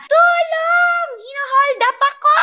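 high, piercing cry for help; screaming for help. Retold or imitated. Woman mugged on the street, screaming for help, high and loud. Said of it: "sumigaw talaga ako ng sobrang lakas".

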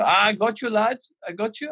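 put-on teasing in Gravesen's Scouse German accent. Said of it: "he's got this weird Scouse German accent going on".

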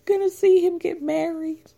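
high, mock-weepy mother. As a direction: whine it like a wounded mom. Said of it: "it's also like, she".

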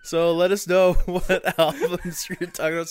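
hungover, laughing through it; rough hungover read. Real, not acted. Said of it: "Sorry, I'm very hungover".

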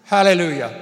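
loud, full-throated praise; a burst of praise in the middle of prayer; shouted hallelujah. Acted. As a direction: belt out the praise.

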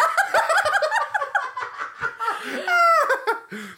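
high squealing laugh; loud burst of laughter. Real, not acted. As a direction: cackle out loud. Big laugh after fuck you.

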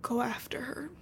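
urgent little stage whisper. Retold or imitated. Henri urging John after Sarah leaves, whispered. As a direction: whisper it under your breath, softly.

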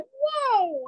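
viel höher, fassungslos; stunned whoa. Retold or imitated. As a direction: react stunned to a slap. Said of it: "I was like".

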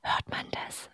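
soft unvoiced whisper. Real, not acted. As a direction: barely breathe the question. ASMR cookie crinkling, whispered.

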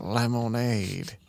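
very slow, stretched Southern drawl; Georgia accent. Acted. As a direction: drag the word out in a Georgia drawl. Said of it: "He's from Georgia".

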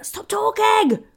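loud, high, drawn-out yell; exasperated shout. Retold or imitated. Comic to French man talking during the gig, exasperated shout. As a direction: yell it at a heckler at the end of your patience.

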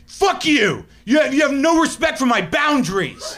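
high-pitched, hammed-up furious scream; screaming in rage. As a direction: scream it like a wounded, too-sensitive rager. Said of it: "I'm screaming".

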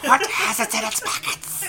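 lightly voiced riddling creature; Gollum. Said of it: "keep the precious in my pocket".

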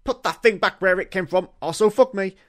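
a light, high cartoon voice; lightly voiced monster; Mike Wazowski voice. Host reading a Mike Wazowski line, lightly voiced. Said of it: "This is Mike Wazowski".

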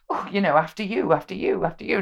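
high, fussy "after you" politeness; overly polite Brit. Retold or imitated. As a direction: defer again and again, prim and mannerly. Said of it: "very stereotypically British, isn't it, to be like".

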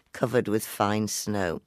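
ordinary delivery

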